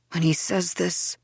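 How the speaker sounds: usual speaking voice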